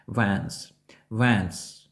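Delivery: "Vans" as first syllable wens, no D; silent D. Shown as a demonstration. Teacher models first syllable of Wednesday, silent D. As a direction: say "Vans" as one syllable without D. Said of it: "Ми вимовляємо це слово як".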